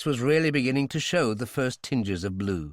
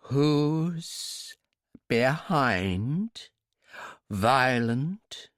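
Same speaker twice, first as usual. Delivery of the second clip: implacable; 3 semitones higher, much slower than usual. Acted. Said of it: "I repeated implacably".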